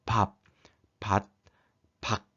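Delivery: 밥, 밭, 박 cut short with hard, stopped endings; 밥, 밭, 박 one at a time, each abruptly blocked at the final consonant. Shown as a demonstration. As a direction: shut off the end of 밥, 밭, 박 with no ringing. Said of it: "소리가 딱딱하고 막힌 느낌을 주죠".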